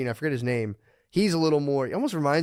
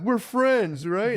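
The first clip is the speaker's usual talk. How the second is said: cheery dad; 10 semitones higher, much slower than usual. Retold or imitated. Dad voiced to daughters, cheery.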